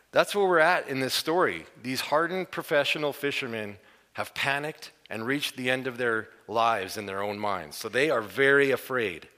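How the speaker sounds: ordinary talk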